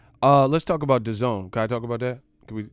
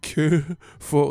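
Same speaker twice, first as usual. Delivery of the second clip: spooky warning; 5 semitones higher, 4 dB louder, much slower than usual. Retold or imitated. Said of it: "He said".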